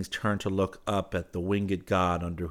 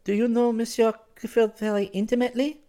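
put-on high, louder girl's character voice; anxious girl; 5 dB louder than usual. Girl asks about his friend Clifford, anxiously. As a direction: probe in a raised, careful voice.

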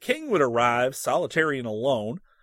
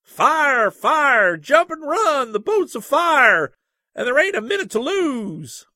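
high, loud panicked yell of fire; a scared boy screaming the boat is burning; shouting; 11 semitones higher, 8 dB louder, slower than usual. A narrator's reading. Wild-eyed boy bursting into the ladies' saloon, loud high shouting. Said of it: "and shouting".

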